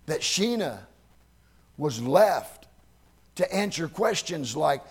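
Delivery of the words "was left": ordinary talk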